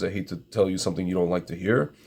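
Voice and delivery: ordinary delivery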